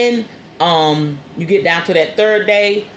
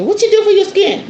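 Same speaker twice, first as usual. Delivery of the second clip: curious asker; 13 semitones higher than usual. Retold or imitated. Imitating people asking her questions.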